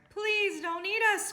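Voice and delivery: scared dogs begging the monster; pleading cry. A narrator's reading. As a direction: beg for your life. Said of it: "cried the dogs".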